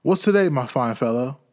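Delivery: cheery call. Acted. Scrooge calling down to boy.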